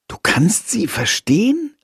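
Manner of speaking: plain reading voice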